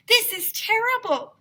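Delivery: put-on high, prim girl's voice; Andrea's voice. A narrator's reading. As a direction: sound scandalised and proper. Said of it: "Andrea said".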